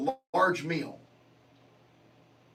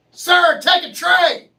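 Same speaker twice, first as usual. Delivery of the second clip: high, loud barked order; mock yell; 10 semitones higher, 11 dB louder, slower than usual. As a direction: yell it like a pushy cashier. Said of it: "they yell at you".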